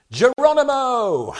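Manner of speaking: long, high shout held out slow; battle cry. Shown as a demonstration. Preacher demonstrates the paratroopers' cry: drawn-out shout. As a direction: yell it like a man leaping from a plane. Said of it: "have shouted".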